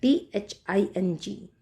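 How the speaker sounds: ordinary talk, nothing marked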